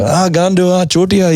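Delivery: high, playful swearing in Hindi; mock swearing greeting. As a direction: toss the insult up high and fond. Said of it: "we tell Akash, you know, it's like".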